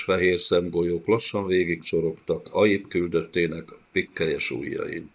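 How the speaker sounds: plain reading voice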